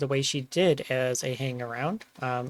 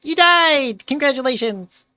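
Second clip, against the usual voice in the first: much higher, much louder mock celebration; excited cheer; 12 semitones higher, 8 dB louder than usual. Real, not acted. Co-host gleefully cheering Nova's death, loud and high. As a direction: yell it like a party announcement.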